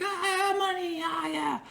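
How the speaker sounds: high, soft squeal of pretend ecstasy; mock delighted stripper. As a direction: coo in a high, hushed falsetto, imitating her delight. Imitates stripper under raining money.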